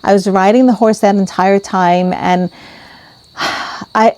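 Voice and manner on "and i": ordinary delivery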